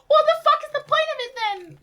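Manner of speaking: high loud exasperated shout. Real, not acted. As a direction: yell it in frustration.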